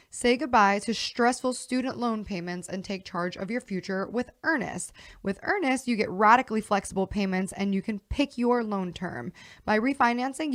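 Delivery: upbeat sponsor read, steady pace. Acted. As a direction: read it like a podcast ad.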